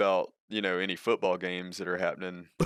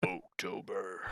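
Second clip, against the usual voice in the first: horror host; 8 dB quieter, slower than usual. Acted. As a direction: breathe it like a ghoul. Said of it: "a ghoul vo-, voice or something for Halloween".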